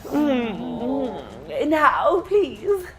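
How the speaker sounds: high, preening satyr; vain satyr voice. Acted. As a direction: play the smug creature host.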